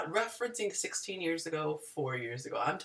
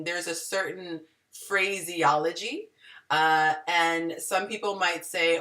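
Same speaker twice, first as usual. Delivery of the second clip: ordinary talk, nothing marked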